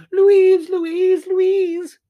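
very high, loud screams; panicked shouting. A narrator's reading. Prue screaming for Louise as the cat lands, panicked shouting. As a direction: shriek the name over and over in panic.

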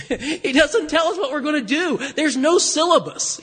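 amused, chuckling delivery; laughing aloud mid-sermon. Real, not acted. Laughs while preaching.

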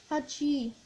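a real sneeze, hachi; sneezing. Real, not acted. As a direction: sneeze sharply. Sneeze after clearing throat.

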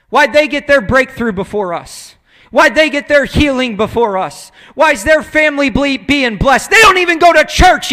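loud, indignant mock complaint; bad attitude. Churchgoer jealous of others' blessings, loud indignant griping. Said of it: "we got a bad attitude".

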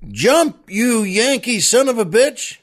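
high mock yell; oil man turns on the jumper, yelling. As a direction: bark it up in scorn. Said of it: "the oil man yells".

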